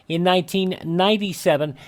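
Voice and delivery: usual speaking voice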